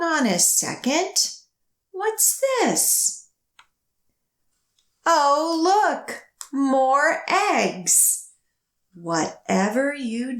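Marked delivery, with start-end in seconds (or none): 1.9-3.3 surprised. Acted. Surprised at more eggs. 5.0-8.3 surprised. Acted. Discovering dragon eggs.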